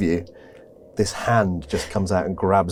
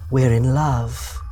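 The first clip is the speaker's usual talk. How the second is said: ghostly lover voice; 2 semitones higher, slower than usual. Acted. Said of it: "you hear Viola's voice say".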